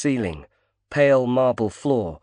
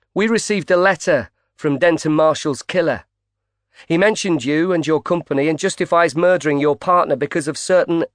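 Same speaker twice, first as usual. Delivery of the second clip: pushy; 6 semitones higher, 4 dB louder than usual. Acted. Reporter confronts Gilder.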